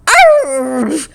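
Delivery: put-on a child's play scream of fright; mock scream. A narrator's reading. Nina is frightened by the noisy bush, screaming. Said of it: "screamed Nina".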